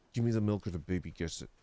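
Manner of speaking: mock villain threat. Threatening over the milk, low mock menace. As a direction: hiss the ransom demand.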